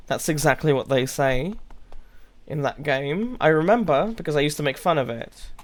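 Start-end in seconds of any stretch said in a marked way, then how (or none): none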